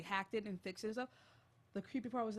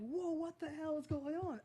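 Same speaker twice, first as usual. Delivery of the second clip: higher-pitched mock astonishment; stunned disbelief; 6 semitones higher than usual. Retelling the shock of watching the video, stunned. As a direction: act out a baffled viewer.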